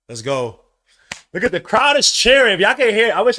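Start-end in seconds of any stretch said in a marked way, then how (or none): 1.3-3.4 higher, louder hyped call; excited. Real, not acted. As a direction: pump up the chat over the crowd. Crowd cheering, he hypes.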